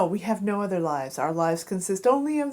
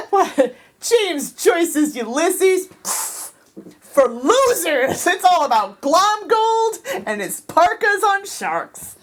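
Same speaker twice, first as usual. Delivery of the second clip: high, drawn-out Scottish cartoon voice; Glomgold; 8 semitones higher, much slower than usual. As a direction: scoff in exaggerated slow Scottish.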